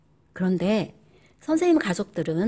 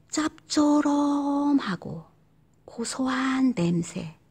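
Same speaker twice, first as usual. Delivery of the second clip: 짭조름하고 drawn out slowly; slow relishing read; savoring; 3 semitones higher, much slower than usual. Shown as a demonstration. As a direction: taste each word slowly. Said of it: "이 말에서 어떤 느낌이".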